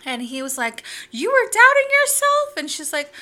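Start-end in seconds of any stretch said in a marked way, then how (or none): 1.1-2.6 high, loud, retold disbelief; incredulous question. Sweet man asks client about her self-doubt, mock incredulous. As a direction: imitate him leaping up in pitch, astonished.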